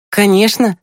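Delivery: put-on high girlish exclamation; amused older sister. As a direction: agree readily in a high, lively voice. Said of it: "Маша засмеялась". (весело, высоким девичьим голосом)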